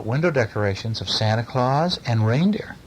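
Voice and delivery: usual speaking voice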